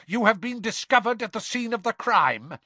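high, forceful charge; angry accusation. A narrator's reading. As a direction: accuse them as caught culprits. Crouch keeps up shouted accusation.

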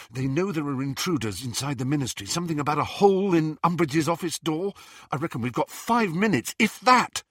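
fast, anxious warning; quick report, rising at the end; urgent young wizard. Acted. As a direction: warn a friend in a hurry. Ron warns Harry urgently.

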